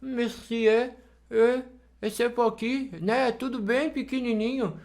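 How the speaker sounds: French-style pouted, rounded vowels; French pout accent. Shown as a demonstration. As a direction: put on a tiny-mouthed French accent. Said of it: "ele tende a falar aqui, ó, dessa forma".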